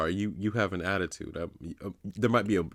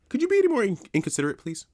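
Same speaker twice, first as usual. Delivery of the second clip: leaps much higher and louder, mocking; mock the rudeness with exaggerated sarcasm; sarcastic rebuke; 13 semitones higher, 4 dB louder, faster than usual. To the rude shopper, a high sarcastic rebuke.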